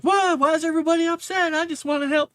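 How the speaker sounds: high, fast, hurt cartoon voice; confused mascot. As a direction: whine it, puzzled and innocent. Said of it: "And he's like".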